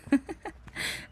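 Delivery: high, airy chuckle; amused laugh. Acted. Receptionist amused by a busy coworker, light laugh.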